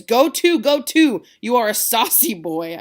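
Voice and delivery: very loud, high, furious scold; Capulet shouting. Acted. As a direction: blast the saucy boy down. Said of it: "Capulet says".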